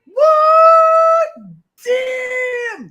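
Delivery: very high, loud shocked cry; surprised shout. Real, not acted. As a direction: shout in sudden disbelief. Learns he reached fifty subs.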